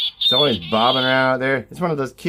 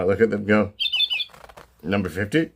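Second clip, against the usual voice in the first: normal talking voice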